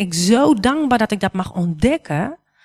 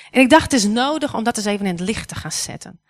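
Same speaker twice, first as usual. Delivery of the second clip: normal talking voice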